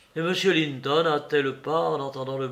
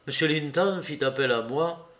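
ordinary delivery, nothing marked

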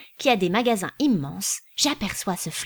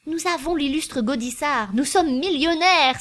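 exultant cry; 7 semitones higher than usual. A narrator's reading. Perfumer exultantly crying out to his cashier. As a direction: crow it out like a man who has just won. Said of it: "s'écria le parfumeur".

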